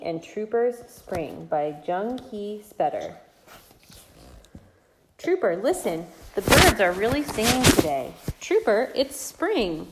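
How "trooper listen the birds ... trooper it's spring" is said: bright, lilting read-aloud voice; lively storytime reading for babies; reading to little children. Acted. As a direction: read it like a bedtime picture book for toddlers. Reading picture book to toddlers.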